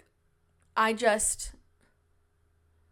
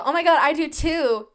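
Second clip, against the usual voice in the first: excited; 6 semitones higher, 8 dB louder than usual. Retold or imitated. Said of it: "And I go".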